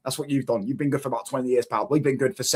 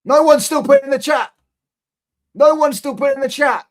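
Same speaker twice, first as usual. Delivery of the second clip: high, loud jeering call to the chat; loud gloating taunt; mocking shout; 11 semitones higher, 7 dB louder than usual. Real, not acted. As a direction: crow over the silence, pitched way up.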